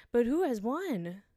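ordinary delivery, nothing marked

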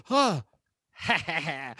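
high cackling leprechaun laugh; shrill little-imp cackle. Retold or imitated. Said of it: "he's like".

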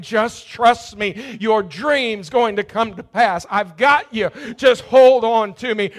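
loud, high, slow reassurance; full-force preaching. Acted. God reassures Joseph through the preacher, loud preaching. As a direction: proclaim it with full conviction. God's voice inside the sermon.

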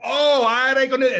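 high, loud burst of recognition; sudden loud realisation. Retold or imitated. Bartender finally understanding the customer's Spanish, loud excited recognition. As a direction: exclaim it fast and high, as it finally clicks.

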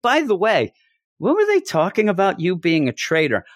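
high, fast, offhand mock explanation; breezy Dwight. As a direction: toss it off like no big deal. Voicing Dwight casually explaining.

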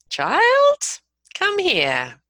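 put-on high, loud 'child', then dropping firmly; parent's warning tone. Shown as a demonstration. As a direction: call a child in with controlled menace. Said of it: "They know that tone of voice".

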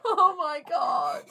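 high, loud laughing exclamation; drawn-out, shrieking amusement; laughing hard. Real, not acted. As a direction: wail it slowly through laughter. Listener cracks up at the outburst.

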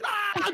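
high-pitched imitation scream; mock kids' scream. Imitating kids freaking out in the theater, mock scream. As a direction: squeal like kids exploding with excitement. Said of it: "and the kids explode".

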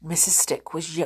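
usual speaking voice

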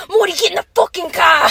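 loud, high mock yelling; mock shouting. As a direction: bark it like a cartoon grump. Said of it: "Don't you remember that one?".

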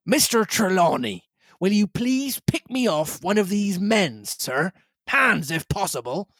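captain ordering the squire to fire; captain's voice. Acted. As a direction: give the order like a ship's captain. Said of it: "said the captain".

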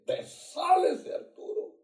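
high, loud, slow order; shouting the final exorcism command. Retold or imitated. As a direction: bark the demon out.

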